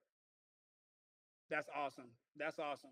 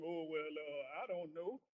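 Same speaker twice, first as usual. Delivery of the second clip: put-on dumb teen self. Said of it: "I was in there talking like some".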